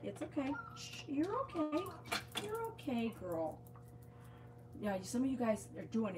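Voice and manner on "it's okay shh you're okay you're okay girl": higher, softer reassurance to a nervous dog; soothing dog talk. Real, not acted. Owner to nervous dog Saki at nail trim, high soothing coo. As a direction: coo her calm, pitched up and soft.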